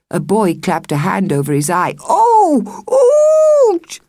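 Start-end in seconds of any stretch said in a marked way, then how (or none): 2.0-4.0 high wailing fake moan; hammed-up groan.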